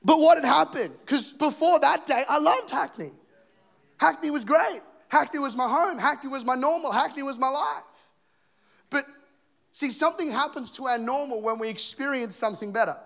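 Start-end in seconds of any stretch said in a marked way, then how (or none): 0.1-11.6 high, emphatic, insistent delivery; raised-pitch, fervent sermon build; impassioned preaching. Real, not acted. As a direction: drive each repeated phrase home from the pulpit. Preacher builds to his point.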